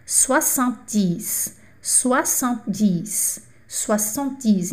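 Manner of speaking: soixante-dix slow and soft, swa-sant-DEES; French soixante-dix. Shown as a demonstration. Teacher drilling soixante-dix for students, slow and soft. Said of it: "soixante-dix é sessenta mais dez".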